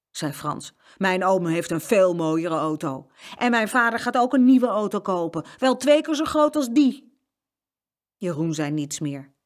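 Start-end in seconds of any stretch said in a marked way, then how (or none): 1.0-7.2 loud bragging that climbs in pitch; show-off boy, louder and higher; boastful. A narrator's reading. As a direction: outdo them, bigger with each claim. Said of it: "Wat moest je nou met zo'n opschepper".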